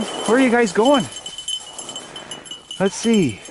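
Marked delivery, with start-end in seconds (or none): none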